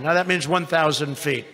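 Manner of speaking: ordinary talk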